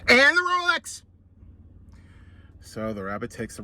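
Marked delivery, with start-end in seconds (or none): 0.1-1.0 much higher squeaky robber voice; squeaky repeated demand. Acted. Squirrel repeats the demand, high and squeaky.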